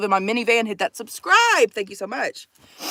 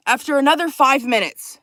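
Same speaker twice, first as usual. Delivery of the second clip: usual speaking voice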